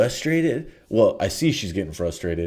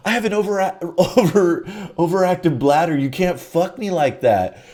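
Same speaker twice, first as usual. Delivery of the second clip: higher, half-laughing imitation of her; mock older woman; 7 semitones higher than usual. Retelling the woman's bladder excuse in a higher mock voice. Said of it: "She's all".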